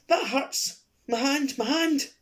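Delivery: shrill, high screaming cries of pain; faked pain scream. Old lady fakes pain to distract the room, shrill screaming. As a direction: scream as if something is biting your hand. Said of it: "Lady Grant screamed".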